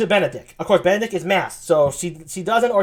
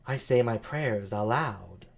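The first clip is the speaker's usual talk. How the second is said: low, slow, soft sultry voice; seductive, unhurried low tones; 8 semitones lower, 7 dB quieter, slower than usual. Acted. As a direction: play her low, languid and sultry. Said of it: "sultry voice like that".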